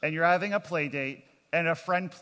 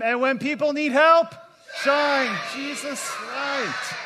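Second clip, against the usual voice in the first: much higher and louder, the last words drawn out slowly; climactic shout; 8 semitones higher, 7 dB louder, slower than usual. Real, not acted. Pastor urges everyone to shout the last line, full-voiced. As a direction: shout the final line as a big finish. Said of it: "come on, one more time".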